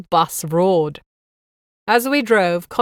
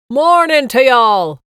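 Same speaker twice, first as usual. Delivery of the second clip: slow, loud, high broad Irish greeting; broad Irish accent; 6 semitones higher, 8 dB louder, slower than usual. A narrator's reading. Bus guide greeting his passengers over the mic, broad Irish accent. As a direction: boom it out in broad Irish like a jolly tour guide. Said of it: "he said in a broad Irish accent".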